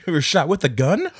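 mock surprise. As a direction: play the stunned kid. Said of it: "the kid sees it and is like".

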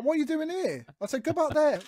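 re-enacted high-pitched indignant order; retold exasperation. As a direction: act out sending someone back, exasperated. Retelling his row with banksman.

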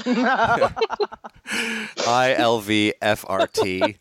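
letters spelled out slowly with a laugh. Shown as a demonstration. Spelling out the license plate slowly while laughing. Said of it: "spelling license plate".

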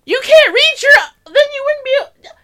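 high, loud outburst of disbelief; exasperated yelling. Real, not acted. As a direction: shout it, utterly exasperated by the argument. Exasperated outburst while arguing.

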